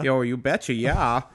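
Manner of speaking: put-on playful Minnesota accent; folksy Minnesota "you betcha". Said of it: "Why are you doing a Minnesota accent".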